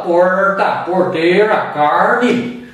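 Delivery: Brazilian caipira accent with a curled R. Shown as a demonstration. Teacher likening American R to countryside Brazil, caipira R. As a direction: hold the caipira retroflex R in each word. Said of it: "o som do R do caipira".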